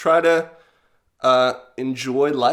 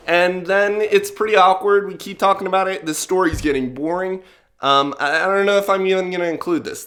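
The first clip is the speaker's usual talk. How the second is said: usual speaking voice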